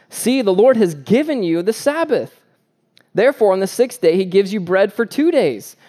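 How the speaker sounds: lifted pulpit reading of scripture. Acted. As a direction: read the passage out with weight.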